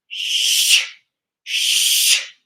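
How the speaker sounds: three sharp hissing shh breaths out; forceful shh. Shown as a demonstration. Teacher showing the class the warrior breath, loud shh. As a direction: push the air out hard on each shh. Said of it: "Watch me".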